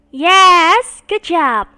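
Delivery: loud joyful yes; cheering yes. Real, not acted. Teacher to class, loud happy cheer. Praising correct answer.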